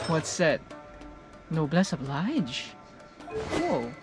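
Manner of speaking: ordinary talk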